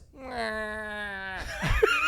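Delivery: 3 crack up mid-story; 1 burst of laughter. Real, not acted.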